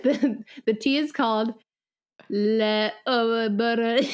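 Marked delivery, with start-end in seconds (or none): 2.3-4.1 put-on French accent. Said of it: "say it in French".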